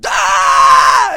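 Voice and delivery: very loud, harsh imitated shriek; horrifying scream. Girl finally catching her breath, screaming. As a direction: let out one long awful scream. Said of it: "the most horrifying".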